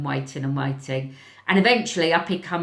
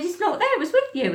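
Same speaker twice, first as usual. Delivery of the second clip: put-on much higher young boy voice; boy's voice; 14 semitones higher, faster than usual. Boy answering his teacher, high young voice. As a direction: lightly voice a cheeky kid.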